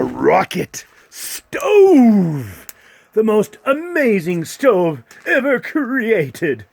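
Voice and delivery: slow grand infomercial; salesman pitch. Acted.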